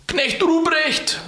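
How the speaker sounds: slow, high Christkind call; high, clear calling voice. Acted. Christkind calling to Knecht Ruprecht, high drawn-out voice. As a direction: call the name out high and long. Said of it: "rief's mich mit heller Stimme an".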